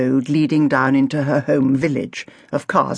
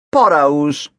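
louder, dismissive shrug of a word; offhand shrug; 4 dB louder than usual. A narrator's reading. Youth telling Agatha the cause, dismissive. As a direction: toss it off like it's nothing. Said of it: "he said with a shrug".

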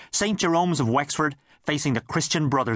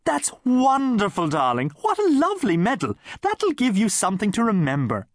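gushing; 9 semitones higher than usual. Acted. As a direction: gush like a stage actress. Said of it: "gushed his mother".

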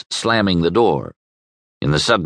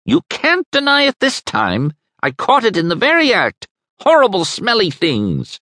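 put-on shrill old neighbour. A narrator's reading. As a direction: spit the word out in disgust. Mrs Arbour accusing Krieger.

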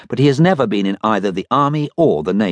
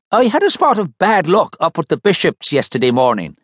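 Slimy's voice; 8 semitones higher than usual. Acted. Slimy reports bad luck.

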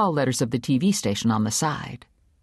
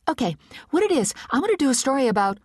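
nervous rush; 12 semitones higher, faster than usual. A narrator's reading. Nervous pitch to boss.

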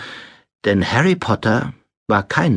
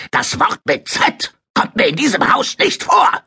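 high, strained raging outburst; tobend; 13 semitones higher than usual. A narrator's reading. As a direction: rage at the boy, voice shooting far up. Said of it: "tobte sein Onkel". (außer sich tobend)

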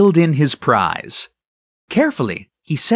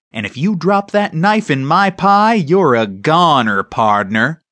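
mock cowboy threat; 4 dB louder than usual. Mother mimics a Western hold-up.

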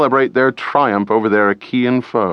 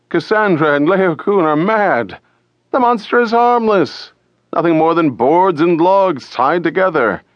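mocking crowd; 8 semitones higher than usual. A narrator's reading. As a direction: laugh off the warning, voice up high. Crowd mocks the skeptics.

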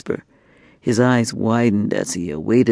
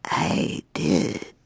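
cold, soft whispered confession; whispered admission; 4 semitones higher, 8 dB quieter than usual. Acted. Dragon woman admitting the killing, cold whisper. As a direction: breathe it out with no remorse.

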